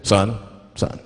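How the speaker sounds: low, deliberate cop voice; police officer. Acted. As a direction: play the officer at a traffic stop, pitched well down. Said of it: "the police pull me over".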